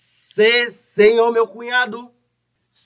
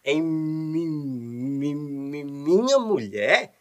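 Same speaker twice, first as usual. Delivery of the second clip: stammering; 6 semitones lower, 7 dB quieter, slower than usual. Acted. Stuttering character Sabino.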